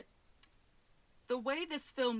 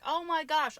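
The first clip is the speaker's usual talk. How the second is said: pitched up, louder with surprise; surprised; 6 semitones higher, 6 dB louder than usual. Real, not acted. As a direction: react with sudden surprise. Surprised the guess was right.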